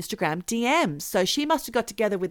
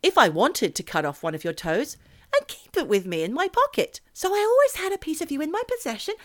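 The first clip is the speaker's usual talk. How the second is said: rising, quicker try at the line; trying out the intonation; 7 semitones higher than usual. Shown as a demonstration. Host reading the creepy message aloud, testing its intonation. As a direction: test how the quote should be intoned. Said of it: "how you'd really intone that question".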